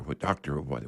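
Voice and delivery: ordinary talk, nothing marked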